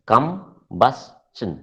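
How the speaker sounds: Combustion in three separated beats, kum, bus, chun; syllable by syllable. Shown as a demonstration. Teacher confirming the class's answer of three syllables, syllable by syllable. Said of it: "Three syllables".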